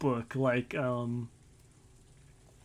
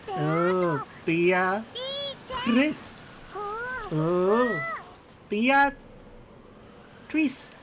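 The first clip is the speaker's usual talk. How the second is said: put-on little girl voice; 9 semitones higher, much slower than usual.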